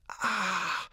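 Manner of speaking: high, sharp cry of pain as sand hits the eyes; a short pained scream; blinded roar of pain. A narrator's reading. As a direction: yelp out, blinded and hurt. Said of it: "brüllte Turiddu geblendet".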